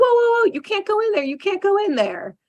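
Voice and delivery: put-on high, urgent little girl crying out a warning; a young girl blurting an anxious warning, pitched high; alarmed kid warning. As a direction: squeak it out like a startled kid stopping him. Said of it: "she's like".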